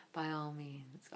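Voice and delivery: very soft, low icy quote; a hint of a chilly fashion editor; movie quote. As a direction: murmur it coolly. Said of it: "her lines in the movie".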